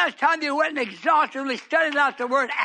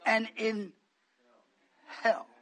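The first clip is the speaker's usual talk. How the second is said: much lower, soft, spaced-out preaching; hushed preaching; 6 semitones lower, 10 dB quieter than usual. Real, not acted. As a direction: draw them in with a hush.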